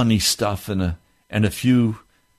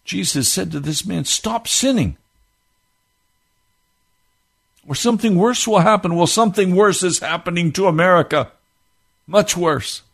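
raised, driving pulpit voice; fervent preaching; 11 semitones higher, 3 dB louder than usual. Acted. Preacher warning America of worse to come, fervent. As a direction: press the warning home.

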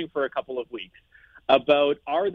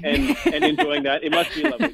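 laughing; 10 semitones higher, 3 dB louder than usual. Real, not acted. Laughing while teasing hosts.

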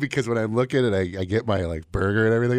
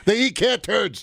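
high squeal; 11 semitones higher, 4 dB louder, slower than usual. Acted.

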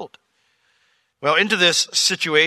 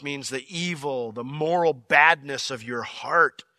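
ordinary talk

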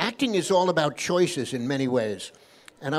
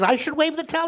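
much higher, astonished husband; incredulous question; 12 semitones higher, 4 dB louder than usual. Acted. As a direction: pitch it way up in disbelief. Joke husband questions rabbi's advice.

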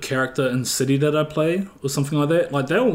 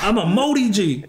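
mock indignant comeback; 7 semitones higher than usual. Acts out his proud comeback.

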